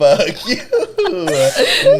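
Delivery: very high, loud squeal through laughter; helpless laughing shriek; laughing curse. Real, not acted. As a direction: curse a friend playfully while cracking up. Playful curse while cracking up.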